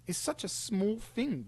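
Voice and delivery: high, slow mocking voice; mocking impression. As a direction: drag it out high to ridicule his excuse. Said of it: "you're saying".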